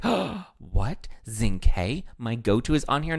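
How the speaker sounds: surprised. Retold or imitated.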